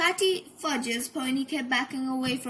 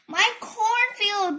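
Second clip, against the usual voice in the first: higher, slow wail; burbling; 8 semitones higher, 5 dB louder, slower than usual. A narrator's reading. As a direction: lament the ruined field. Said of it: "burbled Farmer Fifi".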